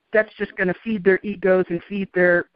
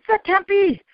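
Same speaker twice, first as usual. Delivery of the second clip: high-pitched, imitated disbelief; incredulous; 11 semitones higher than usual. Gunmen hearing she walks with angels, incredulous. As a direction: voice their amazement, pitched right up. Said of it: "they were so incredulous".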